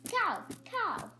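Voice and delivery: high squeaky creature call of cow, cow; a small critter's high call; pet cupcake's cry. Acted. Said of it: "he's always gonna say cow, cow".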